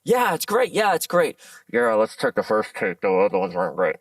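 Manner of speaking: mock rote approval, sinking into a mumble; offhand director. Directors brushing through takes as manager watches, offhand. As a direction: imitate a director saying whatever to move on. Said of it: "directors just saying whatever".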